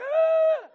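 very high comic cry of hurt; a short squeal of fake agony; mock pained yelp. Pastor reliving the eye poke, mock pained yelp. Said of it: "I'm like".

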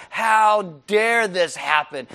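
loud, drawn-out indignation; hammed-up outrage, every word stretched slowly; mock angry. Naaman storming off from the prophet's door, indignant. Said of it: "he storms off and he's angry".

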